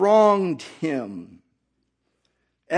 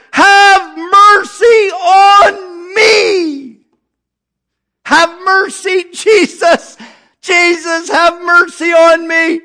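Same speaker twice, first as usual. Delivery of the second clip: desperate wailing plea, much higher and louder; crying out more; 7 semitones higher, 9 dB louder than usual. Acted. Blind Bartimaeus crying louder to Jesus, desperate wailing plea. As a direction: beg for mercy with a crying voice. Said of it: "He cried the more a great deal".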